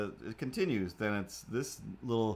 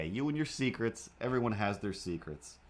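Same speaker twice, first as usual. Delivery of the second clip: ordinary talk, nothing marked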